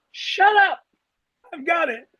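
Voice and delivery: high-pitched mock yell, easing off at the end; imitated scream back. Rookie driver to training officer mid-turn, imitated scream back. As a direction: act out screaming back, voice jumping up. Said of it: "I scream at him".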